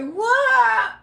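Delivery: high, loud shocked cry; hearing the girlfriend is bragging on him; shocked what. Retold or imitated. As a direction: yelp it in disbelief. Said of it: "I say".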